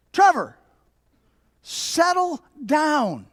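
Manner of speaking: name called high and loud, then very slow; exasperated scolding. Retold or imitated. Dad calling his son who ignored him, exasperated scolding. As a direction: stretch the order out high and firm.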